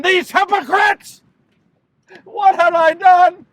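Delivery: high, loud anguish; horrified outcry. Acted. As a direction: burst out in despair. Judas horrified at the crowd and himself.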